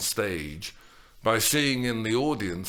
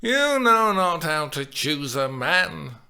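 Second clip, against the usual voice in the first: put-on lecturing old nurse; 7 semitones higher, 5 dB louder than usual. A narrator's reading.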